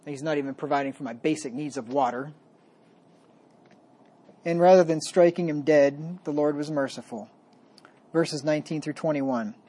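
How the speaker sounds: usual speaking voice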